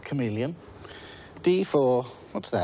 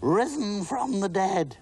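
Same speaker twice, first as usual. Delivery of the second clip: mock horror; 10 semitones higher, slower than usual. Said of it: "in a voice of horror".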